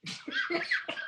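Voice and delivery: breathless, airy burst of laughter; a storyteller cracking up at his own tale; real laugh. Real, not acted.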